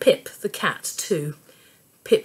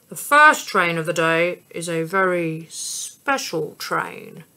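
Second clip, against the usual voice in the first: lightly voiced ticket man. A narrator's reading. Ticket man directing the family, lightly voiced.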